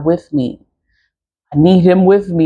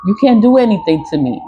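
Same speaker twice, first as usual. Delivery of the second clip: ordinary talk, nothing marked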